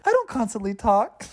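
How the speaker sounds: high-pitched defensive retort; mock indignant. Girlfriend objecting to boyfriend's remark, mock indignant. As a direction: imitate her protesting, offended.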